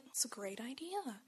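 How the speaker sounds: whispered, dry agreement; sarcastic whisper. Retold or imitated. Friends mocking the shortcut plan, in a whisper. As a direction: whisper it like you don't believe it.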